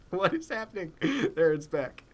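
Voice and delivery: ordinary talk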